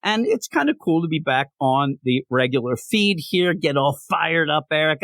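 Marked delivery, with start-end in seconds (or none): none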